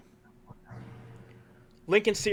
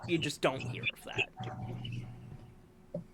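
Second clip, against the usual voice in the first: slow, hushed whisper; whispered aside; 6 dB quieter, much slower than usual. Real, not acted. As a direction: breathe it out in awe. Awed aside on a stat.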